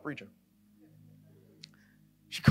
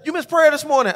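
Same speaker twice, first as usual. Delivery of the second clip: higher, louder voice of a reproachful daughter; scolding daughter; 9 semitones higher, 10 dB louder than usual. Retold or imitated. Daughter calling Dad out over missed prayer, scolding. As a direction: lightly mimic a child telling Dad off.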